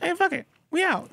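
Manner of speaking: high, offhand character voice; squeaky mock duck; cartoon duck. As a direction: pipe it up high like a cartoon sidekick. Joins in voicing the ducks.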